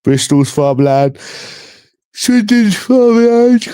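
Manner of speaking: put-on slow, drawn-out West Country farmer accent; exaggerated West Country farmer twang, stretched out; farmer accent impression. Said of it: "That's how they talk like that".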